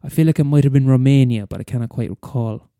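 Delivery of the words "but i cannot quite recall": usual speaking voice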